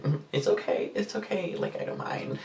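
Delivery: soft unvoiced whisper, pretending not to mind; whispered fake okay. To the apologizing date, a whispered fake reassurance. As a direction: breathe it out quietly through gritted teeth.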